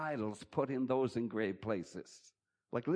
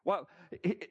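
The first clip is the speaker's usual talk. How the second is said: ordinary delivery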